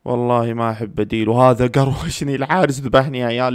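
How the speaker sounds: ordinary talk, nothing marked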